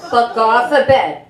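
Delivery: normal talking voice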